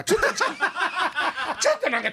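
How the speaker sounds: high laughing, words broken up. Real, not acted. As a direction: giggle through the words. Laughing at the wrong village.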